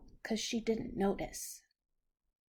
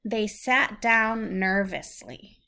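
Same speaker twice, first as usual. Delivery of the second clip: lively, louder storybook reading; 3 semitones higher, 8 dB louder, slower than usual. Acted. Parent reads the happy turn of the story to a child, lively storybook reading. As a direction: build the moment for the child.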